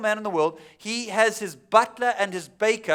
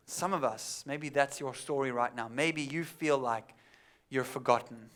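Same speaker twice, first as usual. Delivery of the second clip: gentle sermon appeal; 5 semitones lower, 8 dB quieter than usual. Acted. Quiet reflective preaching turn.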